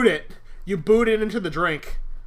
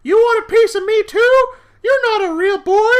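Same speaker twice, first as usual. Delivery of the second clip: much higher and louder taunting puppet voice; creepy puppet voice; 12 dB louder than usual. Acted. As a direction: sneer it like an evil marionette. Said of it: "and he is like".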